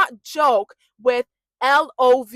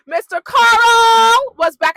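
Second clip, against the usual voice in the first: high, loud, drawn-out name; gleeful stretched-out shout; excited announcement; 11 semitones higher, 11 dB louder, much slower than usual. Real, not acted. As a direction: holler the name like a big reveal. Announcing the good news of return.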